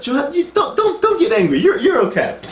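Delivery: high-pitched, hammed-up soothing; playfully exaggerated "there, there" tone; mock reassurance. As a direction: placate someone in a high, stumbling voice. Acts David soothing Joab, amused.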